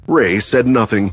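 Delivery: plain reading voice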